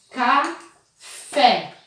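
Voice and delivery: café split slowly, landing on fé; stress on the last syllable. Shown as a demonstration. Teacher sounding out café for pupils, stressed on fé. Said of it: "Quando eu pronuncio a palavra café".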